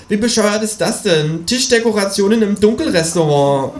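high, exasperated creature voice; indignant kangaroo. A narrator's reading. Kangaroo outraged by table decorations, high and indignant.

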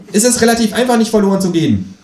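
high, pushy kangaroo voice; a cheeky high voice for the Kangaroo. A narrator's reading. Känguru nags the narrator to clean the bath, high and pushy.

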